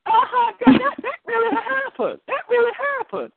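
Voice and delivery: very high-pitched, emphatic affirmation; excited confirmation. Retold or imitated. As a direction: exclaim it high, insisting it is true.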